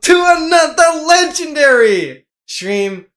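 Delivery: big, high, drawn-out hype greeting; hype stream intro. Real, not acted. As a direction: belt out the welcome like a showman.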